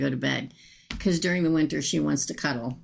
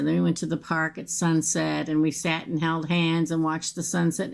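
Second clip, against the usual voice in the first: normal talking voice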